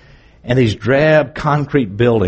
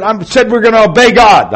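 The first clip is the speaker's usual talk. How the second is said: defiant declaration; 13 semitones higher, 8 dB louder than usual. Retold or imitated. Friend defies secret police.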